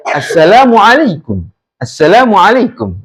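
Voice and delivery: "Assalamualaikum" dropping at the end; falling tone. Shown as a demonstration. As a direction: bring the end of "Assalamualaikum" down, not a question. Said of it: "nadanya kan rendah ya". (nada turun di akhir "Assalamualaikum")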